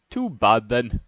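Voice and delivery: usual speaking voice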